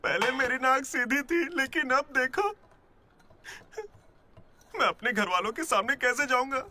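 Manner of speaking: high-pitched wounded complaint; pitiful, high lament after a beating; self-pitying whine. Acted. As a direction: wail about your injuries. Beaten Laddu laments his injuries.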